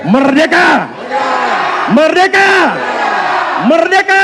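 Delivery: very high, loud chanted cry; rally shout. Real, not acted. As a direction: shout it to the crowd three times. Rally chant ending speech.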